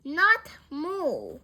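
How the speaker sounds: loud, exasperated correction; emphatic correction. A narrator's reading. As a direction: correct the mishearing firmly. Frank corrects a mishearing.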